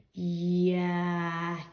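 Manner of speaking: "yak" with the Y sound clear and firm at the start; Y sound demo. Shown as a demonstration. Teacher models "yak" for children learning Y sounds. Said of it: "it says y".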